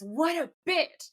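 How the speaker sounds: mean every word of the insult; slow, sincere little judgment; genuine disgust. Retold or imitated. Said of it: "he, like, genuinely means it".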